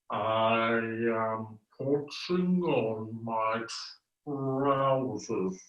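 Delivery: very slow, drawn-out words; slow motion. Shown as a demonstration. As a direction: stretch every word like slow motion. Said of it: "talk in slow motion as well".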